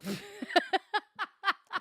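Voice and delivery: high, slow giddy laugh through words; squealing with laughter. Real, not acted.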